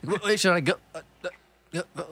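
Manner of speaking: mock Louis C.K.-style dithering; hesitant deer voice. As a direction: stutter like a confused animal. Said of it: "like that one Louis C K bit".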